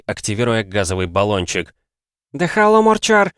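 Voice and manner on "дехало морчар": loud, high-pitched shout in Spanish. A narrator's reading. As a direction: cry out sharply higher and louder. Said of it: "закричала она". (громкий высокий крик)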